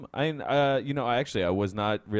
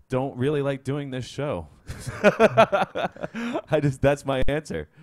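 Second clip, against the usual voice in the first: laughing; 7 semitones higher, 7 dB louder, slower than usual. Real, not acted. Laughing through awkward answer.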